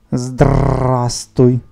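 loud parrot with long rolled R; joyfully shouting parrot. A narrator's reading. Parrot greets the schoolboy, joyful rattling cries. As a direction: crow it out, trilling every R hard. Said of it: "радісно вигукував птах".

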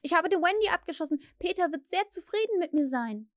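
high, proud boast. A narrator's reading. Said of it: "rief er stolz".

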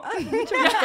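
imitating her sugary intro; mock prissy greeting. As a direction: ham up a dainty hello. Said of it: "y fue como".

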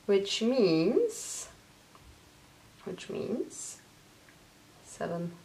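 fading from soft to a whispered hush; dramatic suspense hush. Acted. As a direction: build suspense, sinking into a whisper before the number. Suspense before announcing the winner.

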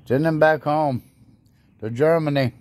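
ordinary delivery

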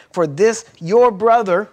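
"your brother" slowed and loud, "your" punched; stress on your brother. Retold or imitated. Father correcting elder son, stressing "your brother". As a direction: lean on "your brother" slowly. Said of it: "He emphasized".

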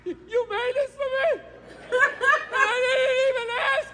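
high, cracking, overcome with emotion; hammed-up choked-up gratitude; mock tearful man. Husband stunned by an unasked sandwich, mock sobbing high. Husband moved to tears, acted.

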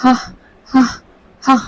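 hammed-up choking gasps of a patient; short strained cries, faking agony; faked writhing groans. Raghu fakes suffocating, strained mock groans. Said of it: "ਤੜਫਣ ਦਾ drama ਕਰਨ ਲੱਗ ਜਾਂਦਾ".

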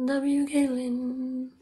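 slow, sustained chant; drawn-out cheer. Real, not acted. Streamer cheering Caitlin on for the chat, drawn-out chant. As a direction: draw the words out evenly.

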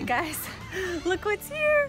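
high, bright burst of delight with a laugh; excited reveal. Real, not acted. Mom showing the family the new mower, excited.

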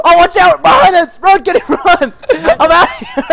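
high, loud, urgent warning; laughing alarm. Real, not acted. Player warning teammate of enemies behind, excited alarm. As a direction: shout a panicky heads-up through laughter.

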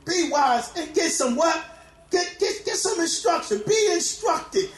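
full-force preaching, shouting over the organ. Real, not acted. Preacher exhorting congregation.

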